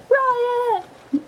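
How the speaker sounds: high mock crowd-rousing cry; calling out. Woman rousing the street to riot, mock yell. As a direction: call it high through cupped hands. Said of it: "cups her hand, mouth around her".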